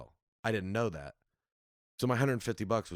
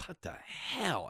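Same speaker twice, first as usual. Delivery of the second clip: slow, drawn-out, higher-pitched disbelief; retold dismay; 7 semitones higher, 6 dB quieter, much slower than usual. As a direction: stretch it out in softer bewilderment. Retold reaction to empty club.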